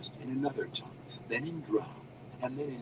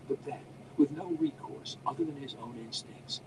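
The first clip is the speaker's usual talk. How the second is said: plain reading voice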